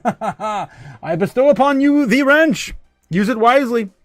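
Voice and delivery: mock royal decree. Streamer awarding a wrench to a new viewer, slow mock-regal proclamation. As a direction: bestow it like a hammed-up king.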